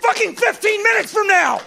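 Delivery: very high, screaming comic tirade; outraged rant. Acted. Comic baffled by a coffee order, screamed rant.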